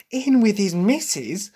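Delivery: high, drawn-out sharp mimicry; pointed and put-upon. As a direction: imitate a sharp, annoyed edge, slow and high. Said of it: "a lot more of a sharp sort of".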